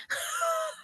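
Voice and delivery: high laugh. Real, not acted. Laugh about bereavement ice cream.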